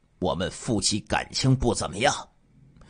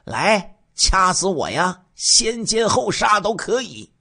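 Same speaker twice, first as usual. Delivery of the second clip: higher, louder brazen taunt; mocking taunt; 10 semitones higher, 5 dB louder, slower than usual. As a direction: dare him to do it, jeering. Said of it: "还笑嘻嘻地说". (高声挑衅嘲弄)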